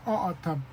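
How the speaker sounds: autumn with a catch in the throat between the two vowels; autumn, o then a glottal catch then o; teaching autumn with the glottal stop; glottal stop word. Shown as a demonstration. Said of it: "in the word autumn, you say".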